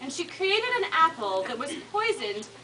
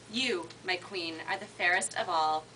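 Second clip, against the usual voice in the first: mirror's voice; 8 semitones lower, 6 dB quieter than usual. Acted. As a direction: intone it low like an enchanted mirror. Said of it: "the mirror replied".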